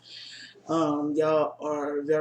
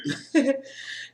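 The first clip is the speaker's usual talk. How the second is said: laugh; 10 semitones higher than usual. Real, not acted. Laughs after hard challenge.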